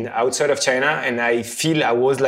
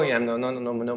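usual speaking voice

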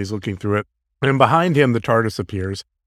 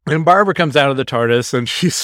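ordinary delivery

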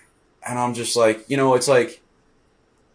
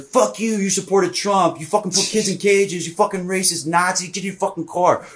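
mock angry rant; 8 semitones higher than usual. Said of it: "And I was like".

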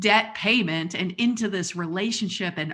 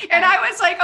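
laughing; 12 semitones higher, 8 dB louder than usual. Real, not acted. Laughing while telling.

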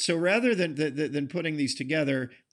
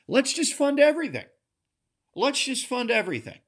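high mocking sing-song, much higher pitch; mocking Democrats; 11 semitones higher than usual. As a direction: mimic them with a high, sneering voice. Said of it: "what they're saying is".

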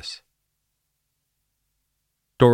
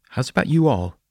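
higher, slightly raised voice; a bit louder; 7 semitones higher, 4 dB louder than usual. A narrator's reading. Woman asks so kids in back could hear, a bit louder. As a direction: lift the voice a little to reach the back. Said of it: "she said a bit more loudly".

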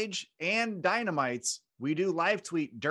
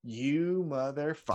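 lightly voiced Rock. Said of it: "The Rock comes out".

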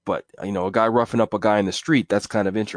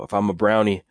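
usual speaking voice

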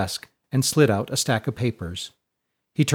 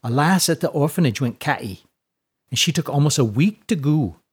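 put-on storytelling orphan girl, lightly characterised; lightly voiced perky girl; 3 semitones higher than usual. Tess tells the others an orphanage tale, lightly perky. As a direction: perk up slightly to tell a tale.

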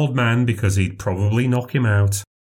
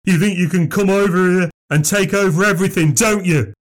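put-on higher, shouted accusing old drunk; ranting shout; 8 semitones higher, 3 dB louder than usual. A narrator's reading. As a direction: hurl it at the newcomers. Fallen drunk ranting at incomers.

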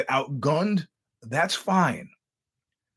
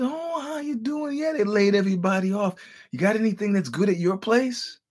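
ingratiating former boss; 7 semitones higher than usual. Retold or imitated. Laid-off boss to mother seven months later, high mock-friendly voice. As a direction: imitate the boss fishing for a favour, pitched high.

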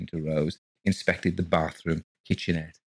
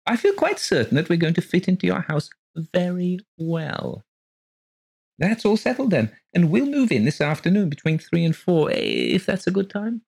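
high, gushing genteel lady voice; gushing landlady; 10 semitones higher, 4 dB louder than usual. Acted. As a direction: pour it out sweetly and breathlessly high. Mrs Colgate gushing.

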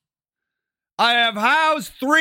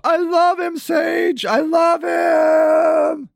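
higher, shouting, drawn out "I love him"; mock whiny girlfriend; 8 semitones higher, much slower than usual. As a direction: wail it like the lovesick girlfriend. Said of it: "I tell her not to show up".

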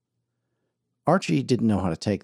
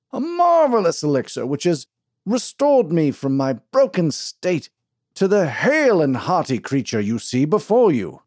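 pompous snake-oil salesman; 7 semitones higher, 5 dB louder than usual. Acted. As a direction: sell the crowd a tall tale like a showman.

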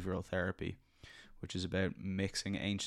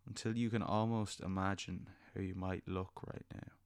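soft spa whisper; 4 dB quieter than usual. Acted. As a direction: gently lead them into calm. Leads a guided meditation.

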